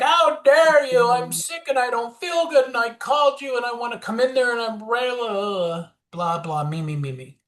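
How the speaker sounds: victimese. Retold or imitated. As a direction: play the wounded victim, outraged and self-pitying. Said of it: "I spoke victimese".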